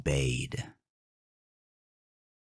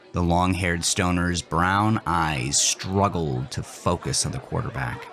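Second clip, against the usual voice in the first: plain reading voice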